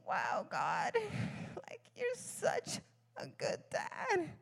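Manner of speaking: choked up and slow, soft through tears; overcome, half-crying; tearful awe. Real, not acted. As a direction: voice breaking with real emotion. Moved to tears addressing God.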